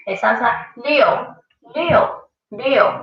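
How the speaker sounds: Liu with a rising tone; demonstrated Liu. Shown as a demonstration. Teacher modelling a rising Liu for students. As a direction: lift the pitch on Liu. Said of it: "repeat after me".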